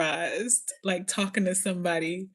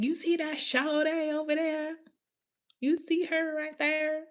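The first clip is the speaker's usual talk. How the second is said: high-pitched Southern accent imitation; 8 semitones higher than usual. Mimicking Southern talk after "Hey, Shawty.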